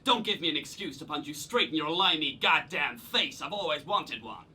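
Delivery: threatening boss in a put-on American accent; hammed-up American officer spoiling for a fight; growing irate, in a mock American accent; ridiculous American accent. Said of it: "Why are you doing that ridiculous accent?".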